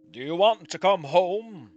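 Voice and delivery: slow, loud fatherly offer; concerned dad. Acted. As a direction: ask it slowly in a put-on dad voice. Acts Dad offering to fetch child.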